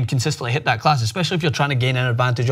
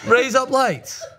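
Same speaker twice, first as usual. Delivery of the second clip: laughing; 10 semitones higher, much slower than usual. Shown as a demonstration. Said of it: "say it in different ways".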